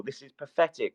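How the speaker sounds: usual speaking voice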